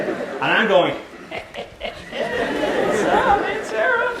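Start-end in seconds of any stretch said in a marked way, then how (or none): 1.3-4.1 very high, squealed taunt; mocking tease. Brother teasing his sister about Aunt Sarah, high mocking squeal. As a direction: squeal it through laughter to wind her up. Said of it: "I wanna tease her".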